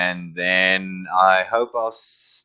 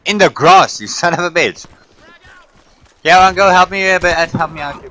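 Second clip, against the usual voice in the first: angry shout; 11 semitones higher, 12 dB louder than usual. Real, not acted. Shouting at enemy and team.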